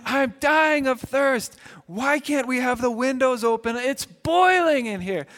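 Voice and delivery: put-on milk the complaint like a hot, bored kid; melodramatic whining kid. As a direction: kid whining at dad about the hot car. Kids complaining in the car.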